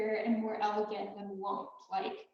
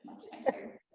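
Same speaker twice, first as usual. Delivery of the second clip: usual speaking voice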